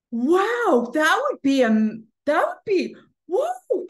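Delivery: high, loud, awestruck wonder; amazed. Retold or imitated. Woman imagining ten thousand a day, high and amazed. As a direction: marvel at the idea. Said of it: "And she goes".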